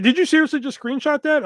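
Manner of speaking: high, incredulous retold question; disbelieving, caught you out; shocked disbelief. Friend catches him screenshotting.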